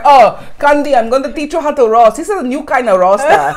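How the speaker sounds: loud, high mock Brazilian accent; exaggerated Brazilian accent. Imitating her colleague offering to teach roasting, exaggerated Brazilian accent. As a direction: ham up a boastful Brazilian accent. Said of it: "it's the best accent".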